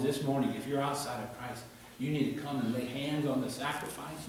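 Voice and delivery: ordinary talk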